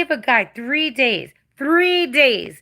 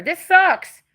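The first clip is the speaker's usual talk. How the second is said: bitter; 7 semitones higher, 5 dB louder than usual. Real, not acted. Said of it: "I sound like a bitter bitch".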